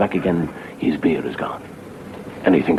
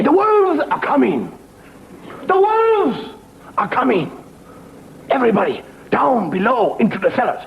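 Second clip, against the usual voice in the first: alarm cry. Acted. Villagers warning everyone of the wolves, high panicked shout.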